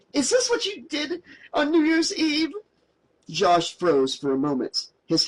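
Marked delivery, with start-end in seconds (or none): 0.1-2.5 much higher accusing outburst; furious. A narrator's reading. Mary confronting Josh about New Year's Eve, higher and furious. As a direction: hurl the accusation at him. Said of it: "She spat out furiously".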